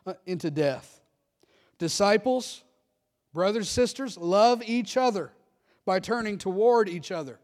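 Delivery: loud, high, rousing pulpit climax; fervent preaching. Real, not acted. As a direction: build the close like a revival call. Closing preaching at full force.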